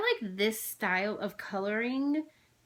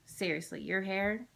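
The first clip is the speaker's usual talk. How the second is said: ordinary delivery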